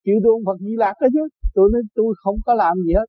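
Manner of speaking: normal talking voice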